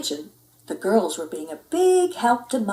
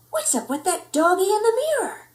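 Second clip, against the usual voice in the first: put-on puzzled kitty boy; 10 semitones higher than usual. Skippy puzzled, high voice.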